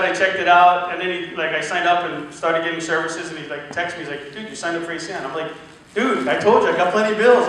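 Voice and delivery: ordinary talk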